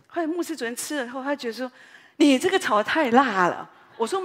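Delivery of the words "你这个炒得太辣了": much louder, protesting; loud complaint. Retold or imitated. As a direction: complain loudly about the dish. Pastor complaining about spicy food. (牧师大声抱怨)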